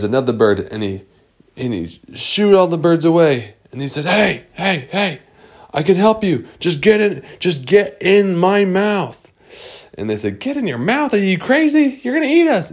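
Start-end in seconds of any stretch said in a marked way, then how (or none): 4.1-9.3 high-pitched urgent bird voice; urgent bird character. Acted. Bird offering the fish help, high and urgent. 10.4-12.7 very high-pitched incredulous protest; incredulous fish. Acted. Fish doubting the bird's offer, high incredulous protest.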